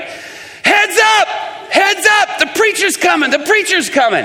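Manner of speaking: high, urgent call imitating a lookout; mock warning shout. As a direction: call out a retold alarm to everyone nearby. Prostitute warning the others.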